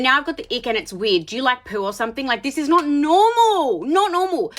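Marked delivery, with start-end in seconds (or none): 2.3-4.5 high, drawn-out exclamation of disbelief; exasperated disbelief. Real, not acted. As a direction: wail it out, stretching "normal". Exasperated at his poo jokes.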